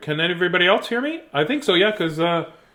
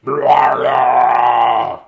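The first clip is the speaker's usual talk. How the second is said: loud low monster blah; yeti grunt; 3 semitones lower, 9 dB louder than usual. Acted. Reader voicing the Yeti, mock monster shout. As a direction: bellow it like a big hairy beast. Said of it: "the Yeti's like".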